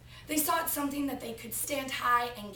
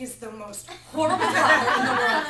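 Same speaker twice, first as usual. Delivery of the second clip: words breaking into laughter, louder at the end; laughing while telling; 11 dB louder than usual. Real, not acted. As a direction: let the laugh take over the line. Laughing at own story.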